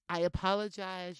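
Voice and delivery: ordinary talk, nothing marked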